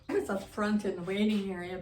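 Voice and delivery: ordinary delivery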